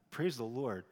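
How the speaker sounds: usual speaking voice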